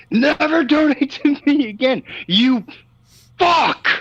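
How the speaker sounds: imitating the rant. Co-host imitating the host screaming at a donator, mock yell. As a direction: replay his friend's yell in a hammed-up way. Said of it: "Like, you".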